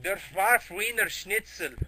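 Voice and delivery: slow, hammed-up fake German; mock German. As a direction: drag out a silly mock German accent. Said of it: "Hitler comes, he goes like".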